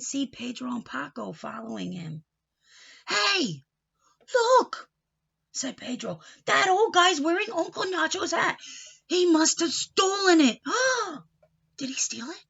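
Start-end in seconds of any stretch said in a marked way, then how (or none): 3.1-11.8 put-on high and loud, kids pointing something out; excited shout. Pedro shouts to Paco, excited and high. Boys spot the hat. 11.8-12.4 whispered question. Acted. Boy whispers doubt.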